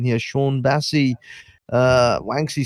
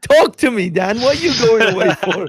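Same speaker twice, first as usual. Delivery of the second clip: high, loud pleading; mock desperate plea; 10 semitones higher, 3 dB louder than usual. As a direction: beg him not to leave, hammed-up. Mock begging Dan to stay.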